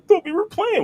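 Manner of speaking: very high, squeaky mock innocence; whiny play-fighter. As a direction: whine it like a hurt kid. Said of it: "they're like".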